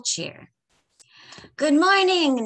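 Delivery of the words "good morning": high, loud cheerful call; calling out cheerfully. A narrator's reading. Old man calling good morning to the witch. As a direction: greet her brightly across the road. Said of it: "he called".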